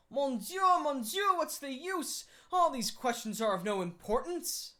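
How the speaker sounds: mock-tired sigh of Mon Dieu; assumed weariness. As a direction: Lupin fakes boredom at the judge, loud theatrical weariness. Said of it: "with an assumed air of lassitude".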